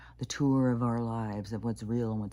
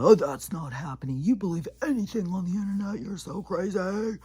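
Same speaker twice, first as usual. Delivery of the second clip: high, mocking whine with a louder start; mocking the brother; 8 semitones higher, 5 dB louder than usual. Retelling her brother's text message as she heard it, in a high mocking voice. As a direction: throw his dismissal back at him as a taunt. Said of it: "this is all in text, but that's how I heard it".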